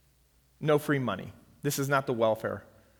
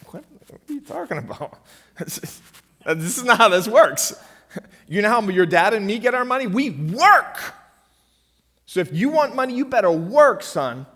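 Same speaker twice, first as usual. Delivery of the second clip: loud, high, laughing parent scolding; mock outraged parent; 7 semitones higher, 10 dB louder than usual. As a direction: play the exasperated dad telling his son off. Parent scolding son over money.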